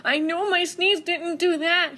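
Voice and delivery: high, jittery bear. Acted. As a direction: reassure himself under the covers. Bear frightened in bed.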